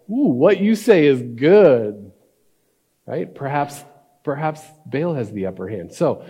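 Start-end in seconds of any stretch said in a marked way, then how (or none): 0.1-2.1 drawn-out, loud, higher "ooh" of approval; impressed crowd. Acted. Prophets of Baal welcome the upper hand, impressed and drawn out. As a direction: play a crowd won over, stretching it out. Crowd reacts to Elijah's proposal.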